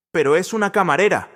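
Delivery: a manager losing his argument, desperate; desperate protest. A narrator's reading. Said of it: "protestó Santiago desesperado".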